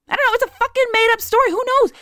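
high, fast, fed-up rant; exasperated outburst. Real, not acted. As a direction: blurt it out, annoyed and high. Exasperated aside about made-up story.

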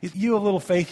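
high, teasing buddy voice; casual dude-style Jesus; friendly Jesus. Acted. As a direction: rib your soaked friend kindly. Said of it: "I think Jesus is just going".

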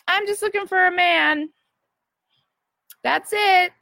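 high, sing-out mock dating profile; mock simpleton plea. Woman mocking a vague dating profile, in a high mock voice.

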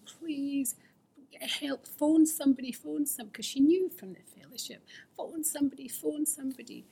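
high, pleading voice; a partner begging in panic; mock frantic pleading. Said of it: "she was saying".

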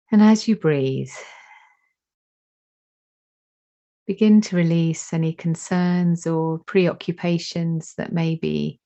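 slow, calm meditation guide voice; guided meditation. Acted. Teacher guiding attention to breath and heart, slow meditation voice. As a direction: let each phrase settle, unhurried.

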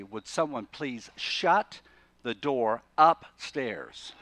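slow, deliberate polite substitute; slow pointed stand-in. Shown as a demonstration. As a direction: drag it out to hint at the real word. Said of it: "I can't say them, but I can say".

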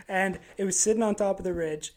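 ordinary talk

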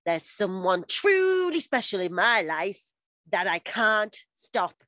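slow, hammed-up love-letter reading; Dawson monster voice. Podcaster reads Dawson's love letter to Gretchen, monster voice. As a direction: overdo the lovesick boy, word by word. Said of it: "entire letter in Dawson monster voice".

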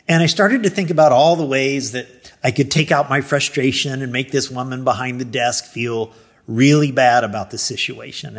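usual speaking voice

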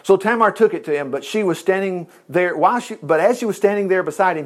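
ordinary delivery

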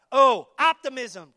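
O, optimism with a loud, slow, stretched O; drawn-out O. Acted. Preacher to the crowd, stretching O loud and slow. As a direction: lean hard into the O of optimism.

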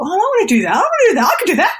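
high, excited me-too plea; acted-out childlike eagerness; eager begging. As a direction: pipe up keenly, pitch leaping. Voicing her eagerness to copy friend.

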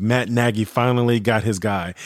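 ordinary talk

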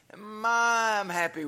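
long, high, loud gloating "my"; mock delight. Preacher pretending he was given a Lamborghini, hammed-up delight. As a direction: stretch "my" out in hammed-up glee. Acting out joy over imagined Lamborghini.

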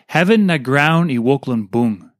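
ordinary talk, nothing marked

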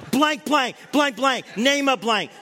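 hammed-up frantic cursing; mock cursing. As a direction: yell the bleeps in panic. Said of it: "Cody's going".

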